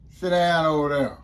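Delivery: loud curt command to the visitor; mock guard order. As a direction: bark it at her like a prison guard.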